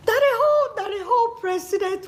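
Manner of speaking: incredulous outburst. Real, not acted. Vlogger stunned at the president's alleged forgery. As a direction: blurt it out in disbelief. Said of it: "I am seriously embarrassed".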